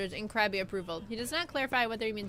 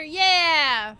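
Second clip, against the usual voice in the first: loud high cheer; excited cheer; 6 semitones higher, 10 dB louder than usual. Real, not acted. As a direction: whoop it out with joy. Cheering return to game.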